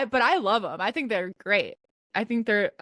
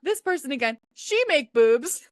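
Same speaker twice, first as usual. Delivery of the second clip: put-on boyfriend's broken English; 5 semitones higher, 3 dB louder than usual. Woman retelling her boyfriend's remark, higher mimicked broken English. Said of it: "he kept saying".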